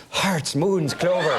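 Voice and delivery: hammed-up cartoon Irish accent; bright-voweled stage-Irish, played for laughs; Lucky Charms Irish. Actor answering his co-star, awful Lucky Charms Irish. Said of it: "and I'm going".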